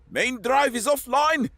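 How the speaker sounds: high urgent yell. A narrator's reading. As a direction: shout it in alarm. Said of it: "Marco yelled".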